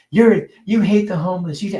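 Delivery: mock accusation. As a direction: ham up the critics' outrage. Mocking far-left critics.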